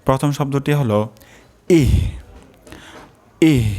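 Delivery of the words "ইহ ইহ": ইহ with a light breath, no heavy h; soft ইহ. Shown as a demonstration. Teacher demonstrates the soft ইহ, unpressed. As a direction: say ইহ gently without pressing. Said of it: "বেশি চাপ দেওয়া যাবে না".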